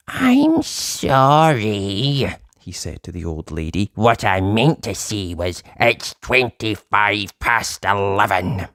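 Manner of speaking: sorry and small; humble Uppity. Acted. Shrunken Uppity apologising to the old lady, slow and meek.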